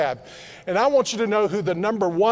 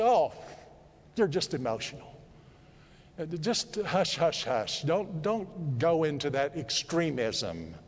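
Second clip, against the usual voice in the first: softly brushing someone off, lower voice; dismissive hushing; 3 semitones lower, 7 dB quieter than usual. Acted. Onlookers to someone turning to Jesus, quiet dismissive hushing. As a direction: hush them down, low and soft.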